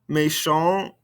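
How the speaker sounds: "Méchant" left open on the nasal vowel; no T at the end of "Méchant"; silent ending consonants. Shown as a demonstration. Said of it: "these ending consonants".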